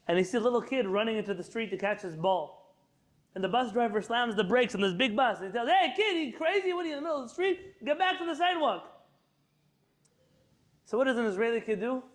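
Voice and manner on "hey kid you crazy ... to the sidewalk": a bus driver's hammed-up yell, higher and laughing. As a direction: shout the kid back off the street. Bus driver yells at kid.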